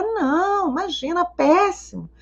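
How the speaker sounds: high, soft mock crying voice; imitating tearful patient. Patient tells her therapist about the relationship, mock tearful. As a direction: whimper it high and soft, as a retold sob. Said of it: "Ela disse, chorando".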